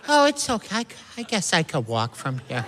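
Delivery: a high "Oh" that drops into a martyred sigh; mock martyr mom; passive-aggressive mom. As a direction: pretend not to mind. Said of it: "real passive-aggressive".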